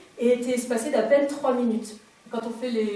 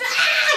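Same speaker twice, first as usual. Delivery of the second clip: cri de douleur; 10 dB louder than usual. Retold or imitated. Douleur de l'accouchement.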